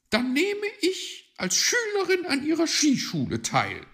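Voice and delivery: very high, loud magpie voice; high, excitable bird figure; exasperated magpie. Acted. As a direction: scold loudly, pitched right up, then concede.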